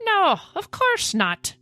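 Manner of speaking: high, slow put-on Irish accent. Patrick answering friend about being open, Irish accent. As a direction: draw it out in an Irish lilt. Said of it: "Oh, he's Irish too".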